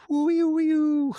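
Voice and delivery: high, loud wavering whoop; wordless high-pitched "whoo-ee-oo"; imitated rattle noise. Imitates a little rattle thing.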